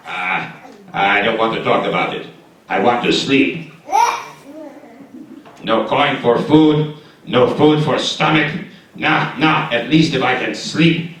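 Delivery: low sleepy grumbling voice; sleepy grumpy friend. Acted. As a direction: play a lazy friend who wants sleep. Lazy sleepy character voice.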